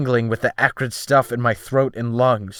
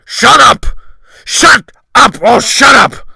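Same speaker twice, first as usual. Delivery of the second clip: screamed at full force, much higher and louder; furious shout; 12 semitones higher, 15 dB louder than usual. A narrator's reading. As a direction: hurl each shut up like a thunderbolt of rage. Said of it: "is shouting".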